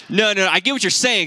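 playful imitation of stubborn refusal; protesting refusal. Mock refusal to God, played up.

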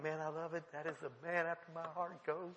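soft, low fatherly reply; retold fond aside. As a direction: drop to a quiet, approving undertone. Father's fond reply to excited son.

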